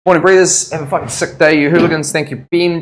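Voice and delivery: ordinary talk, nothing marked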